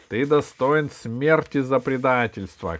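loud, accusing outburst; villain's angry cry. Acted. Кусандра condemns the gnome for betrayal, loud accusation. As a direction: condemn the traitor loudly. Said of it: "крикнул Кусандра".

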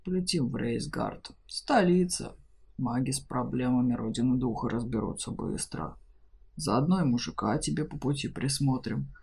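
low, gruff dragon speaking plainly. A narrator's reading. Dragon figure voice.

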